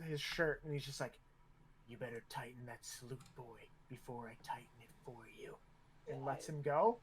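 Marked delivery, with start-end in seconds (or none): 1.9-5.6 low, much softer menacing order; quiet threat. Acted. Officer up in a cadet's face, quietly threatening. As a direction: keep the warning down low, just for him.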